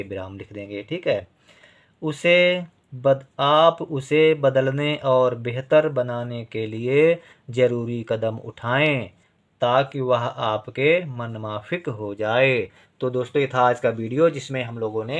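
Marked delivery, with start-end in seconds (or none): 2.0-12.7 whole sentence read slowly for writing; slow dictation. Shown as a demonstration. Teacher to pupils, slow dictation of the full sentence. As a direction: read it out slowly so they can write. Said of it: "reads sentence for dictation".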